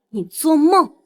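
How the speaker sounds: loud, defiant retort; defiant refusal. Acted. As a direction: throw the offer back at him. Defiant refusal of the rival's offer.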